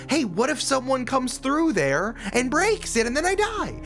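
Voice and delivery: ordinary talk, nothing marked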